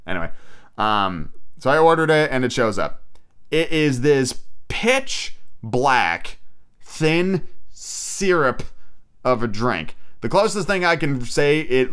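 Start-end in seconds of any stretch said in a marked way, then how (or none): none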